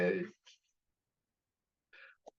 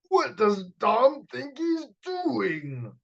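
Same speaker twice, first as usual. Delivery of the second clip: roaring; 8 semitones higher, slower than usual. Acted. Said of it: "Papa Dragon roared".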